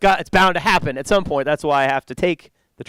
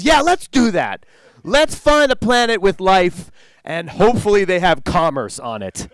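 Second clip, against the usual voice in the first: excited; 6 semitones higher, 5 dB louder, slower than usual. Retold or imitated. Colleague's wild idea acted out.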